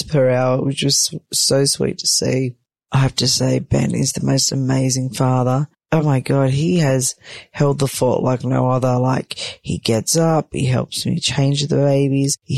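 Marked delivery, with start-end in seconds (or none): none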